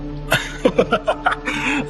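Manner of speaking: high, scoffing laugh; amused laugh. Acted. Actor dismissing a supposed prank, amused laughter. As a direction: laugh it off as a joke.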